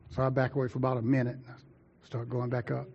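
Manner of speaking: ordinary talk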